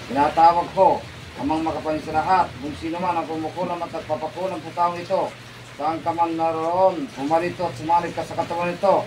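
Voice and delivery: low, steady ritual chant of holy names; low murmured invocation; incantation prayer. Acted. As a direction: intone it like a healer casting out a spell. Recites exorcism incantation.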